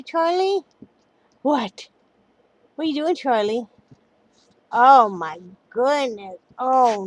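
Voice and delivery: talking to a dog. Real, not acted. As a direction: fuss over the dog lovingly. Cooing at dog.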